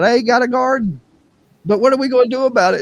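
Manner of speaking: high put-on voice, quick at the end; high, rushed mock comeback; mock reply. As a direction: toss it back in a squeaky voice. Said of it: "I said".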